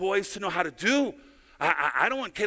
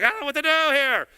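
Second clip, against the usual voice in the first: exasperated; 6 semitones higher, 4 dB louder, faster than usual. Retold or imitated. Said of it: "It's like".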